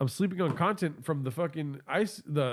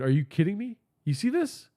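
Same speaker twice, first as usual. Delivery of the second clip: ordinary delivery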